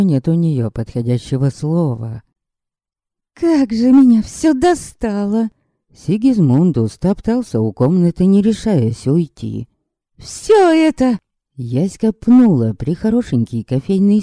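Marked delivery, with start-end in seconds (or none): 3.3-5.5 high, loud fed-up outburst; exasperated girl. Acted. Yaska venting her exasperation, high and loud. 10.2-11.2 high, loud fed-up outburst; exasperated girl. Acted. Yaska venting her exasperation, high and loud.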